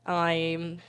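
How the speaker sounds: rough work-in-progress Australian accent; practised but unfinished Australian accent; Aussie accent. Shown as a demonstration. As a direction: try an Aussie wildlife-adventurer accent. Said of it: "I'm working on Aussie".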